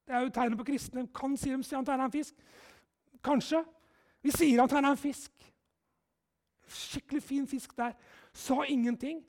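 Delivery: normal talking voice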